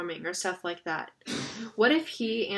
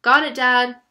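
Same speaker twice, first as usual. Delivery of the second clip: loud, higher-pitched shout; yell; 4 semitones higher, 14 dB louder than usual. A narrator's reading. Jonah yelling to his dad that he has the phone, loud yell. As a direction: call it out loudly to Dad. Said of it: "Jonah yelled".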